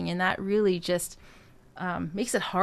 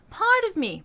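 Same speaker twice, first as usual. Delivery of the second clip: high speaking voice; 9 semitones higher, 3 dB louder than usual. Shown as a demonstration. Coach showing the right high spoken placement. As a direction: speak it high and bright in head register. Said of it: "Remember, it's not this".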